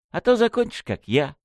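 light teasing warning, starting high; cheerful. A narrator's reading. Revived victim teasing his killer about smoking, cheerful. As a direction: open high, then drop for the punchline. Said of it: "жизнерадостно сказал я".

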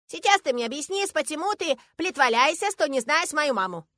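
put-on high, loud child voice, demanding an explanation; stern little girl. A narrator's reading. Girl demanding her father explain himself, stern. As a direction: scold like a strict small child. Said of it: "говорит она строго".

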